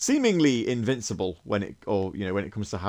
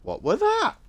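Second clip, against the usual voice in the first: startled; 5 dB louder than usual. Real, not acted. Startled by sudden noise.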